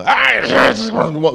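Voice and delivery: high, loud imitated roar; Muhammad Ali victory roar. Boxer stands over fallen opponent, mock victory roar. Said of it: "You know, like Muhammad Ali".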